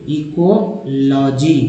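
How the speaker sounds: very slow, stretched "ecology"; broken into parts. Shown as a demonstration. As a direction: sound out "ecology" as "इको", then "logy". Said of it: "इको है".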